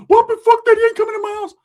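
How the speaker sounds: high, loud outraged yell; Ricky yelling. Retold or imitated. Cousin screams at the intruder, high loud outrage.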